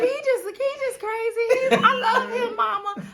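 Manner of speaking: high laughing put-on voice pleading with mama; mock sweet excuse. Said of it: "I'm like".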